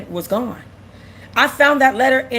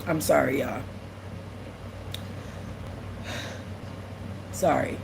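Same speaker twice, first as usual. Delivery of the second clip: soft, lower, tearful apology; barely holding it together; quiet real tears; emotional; 9 semitones lower, 14 dB quieter than usual. Real, not acted. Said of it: "I didn't know I was gonna get emotional".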